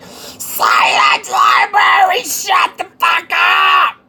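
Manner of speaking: very high, slow, loud screech; screaming library clerk. Acted. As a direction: shriek it at a chatting visitor. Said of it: "desk operator, like, you were just talking".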